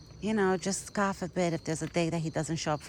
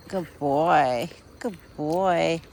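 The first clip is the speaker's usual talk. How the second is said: usual speaking voice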